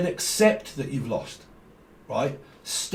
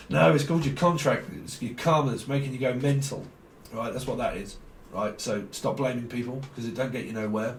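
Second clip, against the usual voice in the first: ordinary talk, nothing marked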